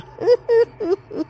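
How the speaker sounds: high, eerie witch cackle; the witch laughing at the fleeing guests; evil cackle. Acted. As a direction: cackle in triumph.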